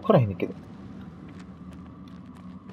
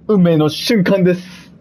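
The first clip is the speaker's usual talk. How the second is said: dramatic announcer; 6 semitones higher, 4 dB louder, slower than usual. Pretend. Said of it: "announcing the big moment".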